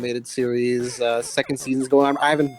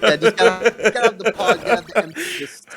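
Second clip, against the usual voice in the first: laughing; 9 semitones higher, 4 dB louder than usual. Real, not acted. Laughing about the joke's timing.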